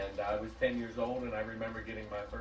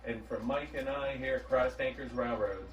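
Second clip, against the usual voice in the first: ordinary delivery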